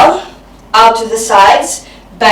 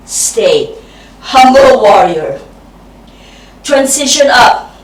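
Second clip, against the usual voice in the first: normal talking voice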